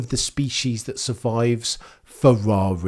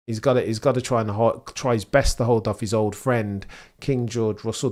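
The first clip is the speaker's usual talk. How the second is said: ordinary talk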